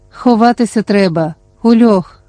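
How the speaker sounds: ordinary delivery, nothing marked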